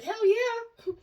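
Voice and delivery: high, loud enthusiastic yes; eager. Retold or imitated. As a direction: burst out with eager agreement. Hairdresser eagerly agrees.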